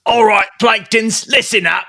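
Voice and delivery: high, loud mob boss voice; gangster shark boss. Pretend. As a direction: bark orders like a cartoon mobster. Voicing toy shark boss.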